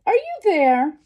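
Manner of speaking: high, loud searching call. A narrator's reading. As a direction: call into the house to find someone. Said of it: "she called".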